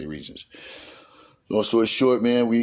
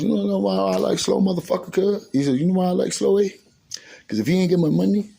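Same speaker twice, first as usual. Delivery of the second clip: boisterous friend; 11 semitones higher, 4 dB louder than usual. Retold or imitated. Friend voiced clowning on Slow.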